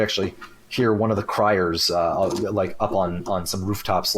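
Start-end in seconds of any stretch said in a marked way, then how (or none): none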